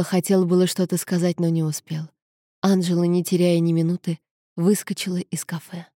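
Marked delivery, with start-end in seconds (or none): none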